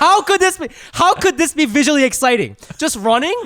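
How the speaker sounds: high, loud disbelief; incredulous rant. Real, not acted. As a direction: protest the absurd premise, pitch jumping up. Ranting in disbelief at anime premise.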